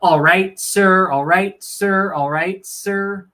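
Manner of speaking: loud, high chant of a retold punishment; retold yell. Recruit yelling into a trash can as punishment, retold yell. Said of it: "yell at the top of my lungs".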